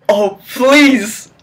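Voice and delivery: usual speaking voice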